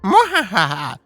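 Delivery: loud, high gloating laugh; evil villain laugh. Acted. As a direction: cackle like a cartoon villain. Villain introduces himself.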